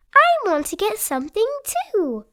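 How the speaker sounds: put-on high eager child voice; Peppa's voice. Peppa asking Daddy for a souvenir, high eager. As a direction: chirp it like a keen little girl. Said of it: "says Peppa".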